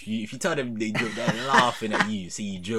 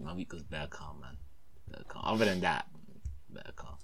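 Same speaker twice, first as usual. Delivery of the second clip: quiet; 10 semitones lower, 14 dB quieter than usual. Real, not acted. Very soft.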